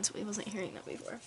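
ordinary talk

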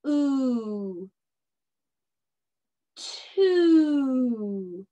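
"Ooh" then "Two" blended; sounding out. Shown as a demonstration. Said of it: "read this one all by yourself".

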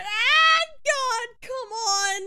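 high, slow wailing cry; anguished wail. Real, not acted. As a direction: drag it out in despair. Desperate frustration.

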